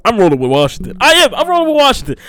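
loud, high, excited announcement. Real, not acted. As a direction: burst out with the pick. Excited pick after hush.

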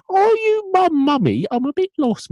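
very high, loud mock child voice; lost child. Gas-mask child looking for Mummy, piercing put-on child voice. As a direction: pipe up like a caricatured lost kid. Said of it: "a child appears in a gas mask".

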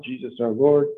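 normal talking voice

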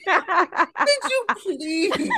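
high, stretched, laughing "Could you please?"; begging mom to stop, laughing high and slow; pleading high squeak. Retold or imitated. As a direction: drag it out high through a laugh. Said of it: "I'm like".

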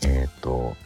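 ordinary delivery

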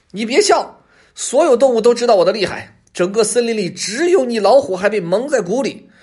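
high, loud fox insisting; loud, self-important fox voice; indignant bluffing fox. A narrator's reading. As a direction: protest hotly and brag, pitched high.

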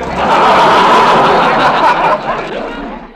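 high chuckle; laugh. Acted. Laugh after joke.

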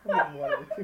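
high, loud, laughing mimicry; mocking Ben. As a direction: crack up while imitating a friend's remark. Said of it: "and Ben's like".

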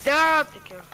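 high, loud excited shout; loud exclamation. Real, not acted. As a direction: call it out hyped. Exclaims during fight.

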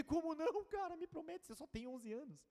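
high pleading cry of disbelief, voice lifting; begging the boy through tears; retold tearful plea. Pastor begging an eleven-year-old boy to promise, tearful high plea. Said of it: "eu comecei a chorar".

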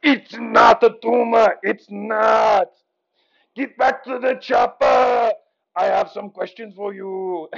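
loud Austrian action-hero bark; hammed-up movie catchphrases; Arnold. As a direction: shout it in a thick Austrian accent. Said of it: "here is Arnold".

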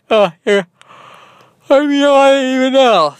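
high, loud talking through a yawn; words swallowed by a big real yawn. Real, not acted. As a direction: yawn widely while still talking. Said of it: "The host is yawning on the podcast".

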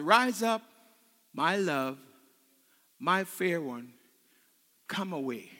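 low, hushed, with long pauses; tender calling. Acted. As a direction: call softly to a beloved, unhurried. Voices Jesus's tender call to beloved.

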